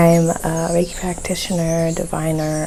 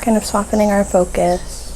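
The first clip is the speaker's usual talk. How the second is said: guided meditation; 5 dB quieter than usual. Real, not acted. Host guiding listeners to soften their focus, guided meditation. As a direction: ease listeners gently into calm, phrase by phrase.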